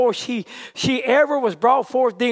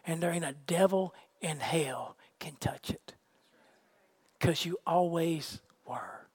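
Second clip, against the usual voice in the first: low, soft, measured sermon voice; intimate, lowered pulpit delivery; hushed preaching; 4 semitones lower, 8 dB quieter than usual. Real, not acted. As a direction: quietly assure the congregation, pausing between phrases. Preacher speaks low to congregation.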